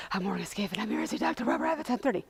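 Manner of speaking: gasping, breathy imitation of sobbing; hysterically crying. Patient to receptionist after driving like mad, hysterically crying. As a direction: act out choking words through panicked breaths. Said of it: "hysterically crying".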